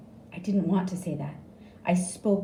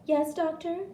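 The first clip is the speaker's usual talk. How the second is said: high nurse voice; lightly voiced young nurse; nurse's voice; 11 semitones higher than usual. A narrator's reading. Nurse answering the doctor, high and bright.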